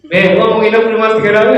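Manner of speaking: a quick, loud, cheeky neighbour asking to stay; lightly voiced pushy neighbour. Neighbour cheekily asking to stay three days, loud and fast.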